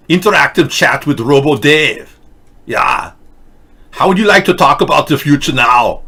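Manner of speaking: mock Germanic robot-cop accent; Arnold Schwarzenegger. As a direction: announce the future like a Terminator with a thick Germanic accent. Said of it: "That's just Arnold Schwarzenegger's inability to cope".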